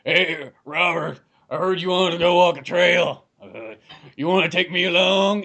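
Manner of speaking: a hint of a washed-up old friend asking to tag along; imitating Nick Nolte. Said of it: "it's more like".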